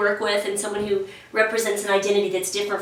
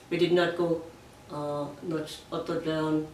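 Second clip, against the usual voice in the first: low, very soft flat voice; flat robotic monotone; 6 semitones lower, 11 dB quieter than usual. Shown as a demonstration. Agent holding the monotone to show it. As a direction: keep it level, like a robot. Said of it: "I was talking like this".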